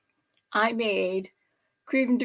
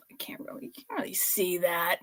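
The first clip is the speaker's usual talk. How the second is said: muttered under breath; whispered aside; 5 semitones lower, 10 dB quieter than usual. Real, not acted. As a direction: whisper it to yourself. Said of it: "whispered aside".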